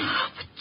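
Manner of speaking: quick shocked intake of breath; sharp tearful gasp. Acted. Client gasps when the detective refuses her. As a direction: gasp as if about to cry.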